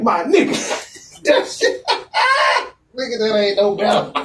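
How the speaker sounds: real laughter breaking up the words, high; laughing through it. Real, not acted. Storyteller reacting to his friend's excuse, laughing high.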